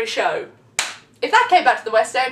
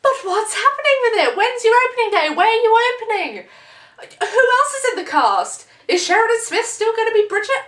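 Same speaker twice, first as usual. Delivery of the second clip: high, loud string of questions; demanding questions; 8 semitones higher, 3 dB louder than usual. Acted. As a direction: pester them with questions. Firing questions at producers.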